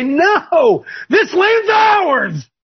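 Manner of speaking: high, slow, shouted claim of the land; rally the church with a shout; preacher shouting a defiant claim; defiant cry. Retold or imitated. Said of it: "stand up and say".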